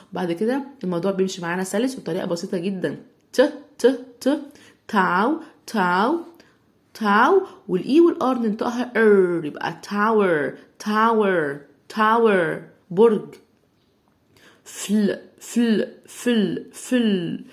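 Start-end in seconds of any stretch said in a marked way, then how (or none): 3.3-7.4 ت tapped, then تاو said loud; loud sounding out. Shown as a demonstration. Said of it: "blending تاو sound by sound". 14.6-17.5 slow sounding out. Shown as a demonstration. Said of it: "slow blending of فل".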